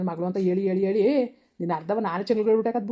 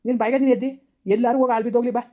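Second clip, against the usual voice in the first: loud, raised angry yelling; father yelling at his daughter in hospital; furious shout; 2 semitones higher, 5 dB louder than usual. Acted. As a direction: yell at her in fury.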